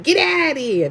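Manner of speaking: put-on New York accent, half-playful brush-off; New York attitude. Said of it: "Why you talking like that?".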